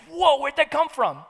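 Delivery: sudden high loud alarmed "whoa"; startled pilot. Retold or imitated. Pilot startling passengers with a sudden loud cry. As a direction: jolt the line out like a caught-off-guard pilot.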